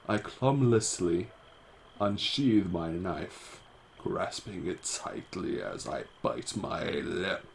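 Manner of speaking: slow, deliberate storytelling; lingering suspenseful read; story narration. A narrator's reading. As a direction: draw the words out slowly as you narrate. Reads the game text.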